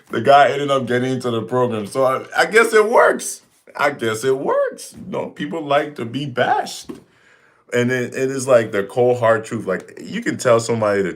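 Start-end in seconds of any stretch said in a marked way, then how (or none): 0.1-6.9 high, loud ironic delivery; mock the idea that it works; sarcastic amused punchline, pitched up. Real, not acted. Ironic punchline after laughing.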